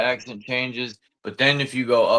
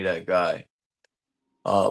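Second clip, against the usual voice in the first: normal talking voice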